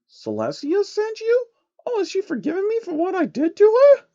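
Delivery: very high, eager pleading princess; a hopeful princess longing for forgiveness; high and hopeful, near tears. Acted. Luna voiced.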